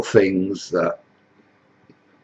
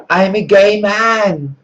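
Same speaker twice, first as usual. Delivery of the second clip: much higher, slow drawn-out answer; camp migrant; 11 semitones higher, 4 dB louder, slower than usual. Acted. Migrant tells Jesus Farage who he is, hammed-up camp. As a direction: say it proudly and slowly.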